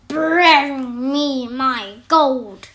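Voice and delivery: slow, loud roaring giant. Acted. Greedy giant roaring at the cook for gold. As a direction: drag the words out in a roar. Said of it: "roared the giant".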